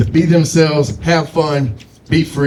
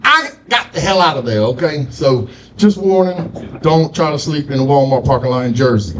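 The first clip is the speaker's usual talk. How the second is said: ordinary delivery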